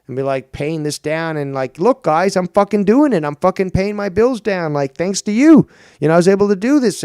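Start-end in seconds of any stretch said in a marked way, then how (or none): 1.8-7.0 loud, higher, rushing thanks; excited update. Pretend. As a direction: proudly tell the viewers you're paying it down. Imagined own grateful update.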